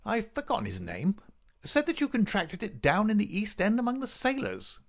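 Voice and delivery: high-pitched, taunting villain voice; sneering villain. Acted.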